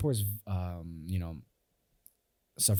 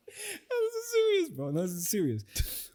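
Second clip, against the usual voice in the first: words squeezed out through giggles; laughing while talking; slower than usual. Real, not acted. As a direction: try to speak through laughter. Cracking up while defending himself.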